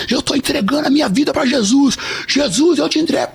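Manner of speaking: high-pitched fervent retold vow; fervent surrender. Man telling Jesus he gives his life, fervent. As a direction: re-enact a desperate, high declaration.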